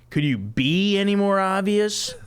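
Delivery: high sarcastic Chandler stress; Chandler sarcasm. Acted. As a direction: lean on be, sarcastic.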